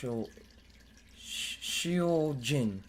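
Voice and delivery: slow, drawn-out name in a Japanese accent. Shown as a demonstration. Streamer sounds out a name in a Japanese accent. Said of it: "sound like I'm saying it in, like, a Japanese accent".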